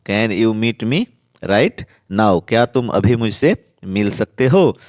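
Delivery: normal talking voice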